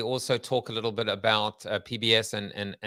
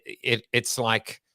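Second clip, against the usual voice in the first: usual speaking voice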